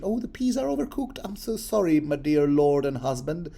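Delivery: imitating a dutiful young wife; mock Sansa. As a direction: play the dutiful wife apologizing. Voicing Sansa's dutiful courtesy.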